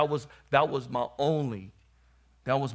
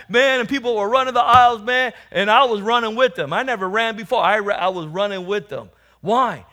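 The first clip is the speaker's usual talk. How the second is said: loud, raised excited testimony; excited preaching; 8 semitones higher, 12 dB louder than usual. Acted. Preacher recalling a church running the aisles, excited preaching. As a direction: whip up the congregation with the story.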